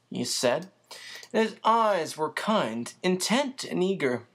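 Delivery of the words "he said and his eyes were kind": ordinary delivery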